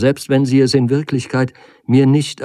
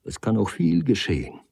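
plain reading voice

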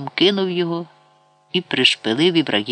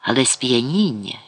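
usual speaking voice

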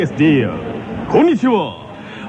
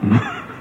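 short high laugh; 4 dB quieter than usual. Real, not acted. Laughs at the dialect remark.

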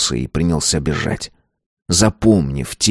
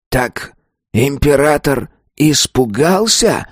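breathed out; 7 semitones higher, slower than usual. A narrator's reading. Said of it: "выдохнул он".

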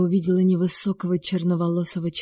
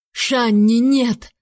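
loud, higher desperate scream of the name; anguished yell; 4 semitones higher, 4 dB louder, much slower than usual. A narrator's reading. Stranger screams as the girl falls, anguished yell. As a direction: tear it out of your throat. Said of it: "надрывно заорал черноволосый".

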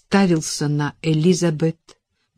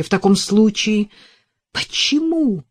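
louder, high-pitched why; cried out; 5 semitones higher, 4 dB louder than usual. A narrator's reading. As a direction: fling the question at her. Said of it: "выкрикнул он".

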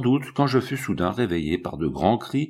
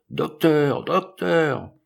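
high, drawn-out call to someone; stentorian call; 6 semitones higher, much slower than usual. A narrator's reading. Man at a locked door calls to the doctor, stentorian call. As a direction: call out, pitched well above the narration. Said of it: "il appela d'une voix de stentor".

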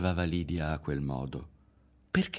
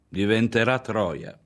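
raised, drawn-out declaration; loud pronouncement; 4 semitones higher, 6 dB louder than usual. A narrator's reading. Mother passing judgement on the girl, loud pronouncement. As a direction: pronounce it loudly and slowly, higher in pitch.

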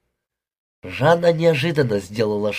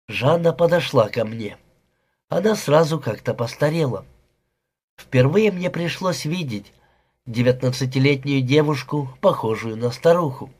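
ordinary delivery

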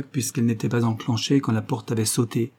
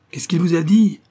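loud, higher demanding roar; roared question; 8 semitones higher, 7 dB louder than usual. A narrator's reading. Policeman roaring at Simon, loud and suspicious. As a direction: bark the question at him. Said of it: "rugit l'un des hommes".

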